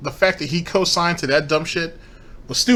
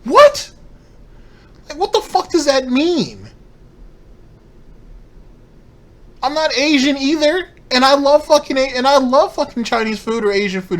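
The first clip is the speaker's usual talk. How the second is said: incredulous outburst; 12 semitones higher, 6 dB louder than usual. Real, not acted. Outraged reaction to reasoning.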